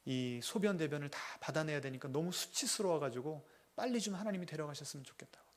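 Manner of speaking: usual speaking voice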